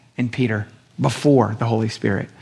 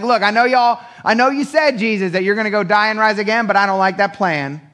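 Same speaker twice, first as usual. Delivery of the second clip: mock cocky Peter; 6 semitones higher than usual. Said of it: "being like".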